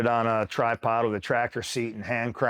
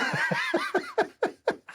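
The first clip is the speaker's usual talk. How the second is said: very high real laughing fit; amused laugh. Real, not acted. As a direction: burst out laughing at your own punchline. Laughs at commander's reply.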